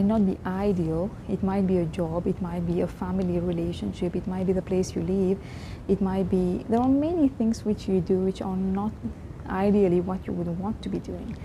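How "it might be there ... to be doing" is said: normal talking voice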